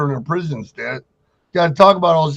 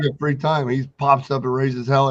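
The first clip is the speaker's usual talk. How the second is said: ordinary delivery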